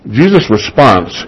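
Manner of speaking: ordinary talk, nothing marked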